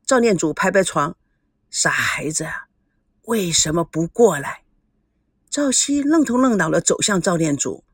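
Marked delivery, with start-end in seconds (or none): 1.7-4.6 tender whisper. Acted. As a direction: coax the boy in a hushed breath. Grandfather calls grandson over. (轻声耳语)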